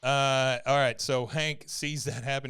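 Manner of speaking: ordinary talk, nothing marked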